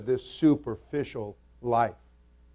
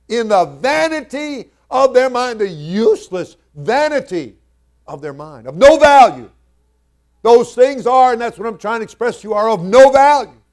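full-force preaching; 6 semitones higher, 11 dB louder than usual. Real, not acted. Preacher declaring scripture to the congregation, full-force preaching. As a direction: preach it out loud and high, stretching the weighty words.

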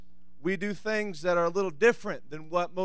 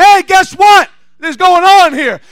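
loud, high burst of news; acted excited announcement; 11 semitones higher, 19 dB louder, slower than usual. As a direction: call it out like you have to tell everyone. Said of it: "You're gonna be excited about it".

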